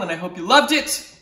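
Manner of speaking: ordinary delivery, nothing marked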